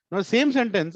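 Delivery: usual speaking voice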